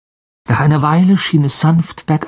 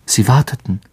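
ordinary delivery

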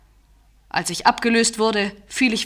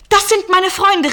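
high, louder glad exclamation; excited cry; 9 semitones higher, 4 dB louder than usual. A narrator's reading. Jim spotting the English flag, exclaiming with relief. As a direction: call it out with relief at seeing friends. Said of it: "rief ich aus".

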